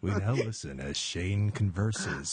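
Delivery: low, slow, hushed nature-show narration; teasing a friend on the phone with fake narration; mock documentary narrator. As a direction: announce it like a wildlife documentary.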